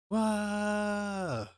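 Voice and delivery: put-on high startled wail from a child; kid's cry. Frightened kid crying out at the snake, high wail. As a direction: let out a sudden high wail. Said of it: "kid cries out".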